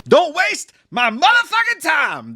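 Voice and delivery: mock Pacino rage; Al Pacino demon. Host voices the demon as a ranting Al Pacino. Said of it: "the demon's Al Pacino".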